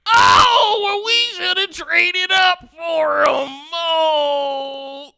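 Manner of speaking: hammed-up loud crying, high and slow; annoying Twitter fan. Bulls fan regretting no trade-up, mock sobbing shout. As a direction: wail like a whining fan. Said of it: "that is the voice of the, of the annoying Twitter Bulls fans".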